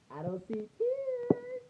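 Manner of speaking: very high, drawn-out squeal; soft falsetto, stretched out; cooing tease. Retold or imitated. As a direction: coo it slowly in a tiny high voice. Teasing the crying little cousin.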